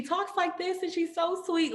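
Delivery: high, slow, sugary-sweet voice; a high and slow gentle sweetness; Sydney's sweet voice. Retold or imitated. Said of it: "that ain't even how she talked".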